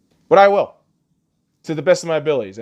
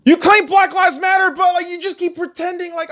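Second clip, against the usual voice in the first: much higher, much louder enraged yelling; furious shout; 14 semitones higher, 4 dB louder than usual. Real, not acted. Vlogger raging about the killer's record, in a furious shout. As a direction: blast it out in real fury.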